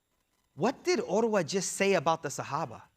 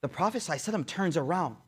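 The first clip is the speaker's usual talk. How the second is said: ordinary talk, nothing marked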